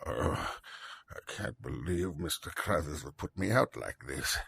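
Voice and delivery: sad. Acted. Ruined banker father, sad scene.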